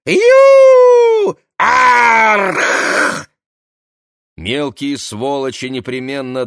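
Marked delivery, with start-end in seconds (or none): 0.1-1.4 high piercing yell; shrill shout. Acted. Cry at start of story. 1.6-3.3 loud growling cry; harsh shout. Acted. Second cry.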